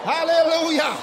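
high whoops and cries of praise; exultant shouting. Shown as a demonstration. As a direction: show the congregation how to shout glory. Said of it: "you say".

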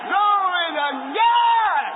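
high, loud, drawn-out cry of praise; jubilant shout. Real, not acted. Preacher bursts out praising God to the congregation, a slow, loud, high shout. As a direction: stretch it out and raise the roof. Preacher exults after a laugh.

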